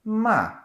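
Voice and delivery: loud "Ma" starting high and dropping sharply; fourth tone, falling. Shown as a demonstration. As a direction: hit "Ma" high, then let it fall. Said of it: "them all in a row".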